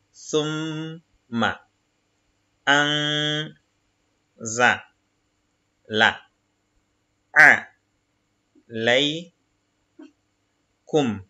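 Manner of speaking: each Arabic syllable said apart, slowly; syllable reading. Shown as a demonstration. Teacher reading Arabic syllables to the class, slow and separated. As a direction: spell the word out syllable by syllable. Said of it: "Ok, donc".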